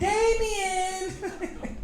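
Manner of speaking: high laughing shout of a name; laughing outcry. Real, not acted. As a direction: cry out the name through laughter.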